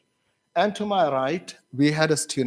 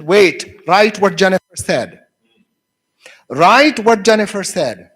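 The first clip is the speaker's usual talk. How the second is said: loud, raised-pitch classroom command; teacher's instruction; 4 semitones higher, 8 dB louder than usual. Retold or imitated. Teacher telling students to write it down, loud instruction. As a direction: tell the whole class firmly and loudly.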